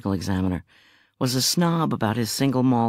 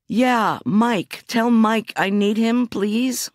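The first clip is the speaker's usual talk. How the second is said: higher, louder, slower urgent plea; urgent request; 12 semitones higher, 4 dB louder, much slower than usual. Acted. Cooper urging the officer to bring Mike, raised and urgent. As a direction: press him hard, slowly.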